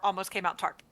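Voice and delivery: normal talking voice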